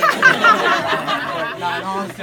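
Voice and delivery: loud laughter running through the words; laughing. Real, not acted. Friend teasing the birthday girl, laughing loudly. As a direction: tease through a big laugh.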